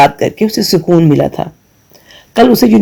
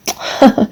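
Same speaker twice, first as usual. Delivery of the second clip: breathy laugh; 6 semitones higher, 4 dB quieter than usual. Real, not acted. Narrator laughs out of story.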